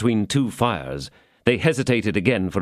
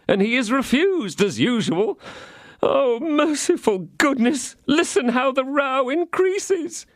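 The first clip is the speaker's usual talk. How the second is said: innkeeper; 11 semitones higher than usual. A narrator's reading. Innkeeper voiced in story.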